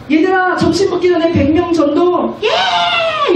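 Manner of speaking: put-on rally the kids, then cheer back; rallying shout. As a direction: youth leader firing up teens before outreach. Leader and teens rally cry.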